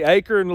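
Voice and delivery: "acorn" pronounced AY-kern; own regional pronunciation. Shown as a demonstration. Asking viewers who say it likewise, acorn as AY-kern. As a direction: say "acorn" with a weak second syllable, kern. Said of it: "that's how I say it".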